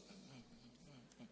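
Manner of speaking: soft high mock mumble; muffled grumble. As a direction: hum it sulkily, lips closed. Imitating the daughters' reluctant noise. (gumaman cemberut)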